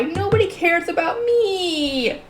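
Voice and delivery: theatrical sob of a tragic heroine; crying out. A narrator's reading. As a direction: wail it through fake tears. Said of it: "burst into tears and cry".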